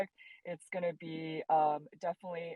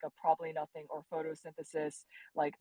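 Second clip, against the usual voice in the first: ordinary talk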